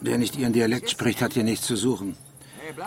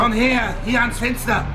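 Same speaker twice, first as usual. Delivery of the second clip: higher, louder urgent call; tense raised command; urgent shout; 13 semitones higher, 4 dB louder than usual. Acted. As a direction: drag her to the window with your voice. Urgent orders during the attack.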